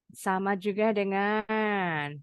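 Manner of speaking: usual speaking voice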